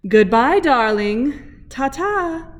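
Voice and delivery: lightly voiced prim aunt waving goodbye; fancy proper aunt. Aunt Silver sees Spoon off, lightly voiced fancy aunt. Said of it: "She's very fancy and proper".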